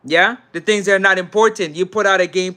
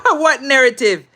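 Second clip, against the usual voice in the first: incredulous laugh breaking into the question; laughing disbelief; 9 semitones higher, 5 dB louder than usual. Real, not acted. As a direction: burst out laughing, high and loud. Laughs at a viewer's comment.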